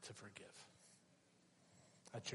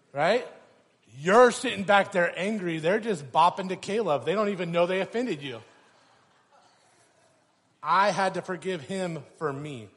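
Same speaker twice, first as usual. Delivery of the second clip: loud, higher, fiery sermon delivery; preaching at full force; 5 semitones higher, 9 dB louder than usual. Acted. Preacher rousing the congregation about road rage, at full force. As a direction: drive the point home to the crowd.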